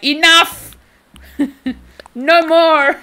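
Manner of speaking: mock Antonio shout. Streamer mimics Antonio yelling at the shrimp debate, hammed-up shout. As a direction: ham up a friend's fed-up cry. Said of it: "Antonio over here being like".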